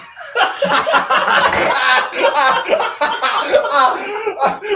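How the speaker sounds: howling high laugh; loud burst of laughter. Real, not acted. Cohost cracking up at the leprechaun joke, howling laugh. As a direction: crack up loudly.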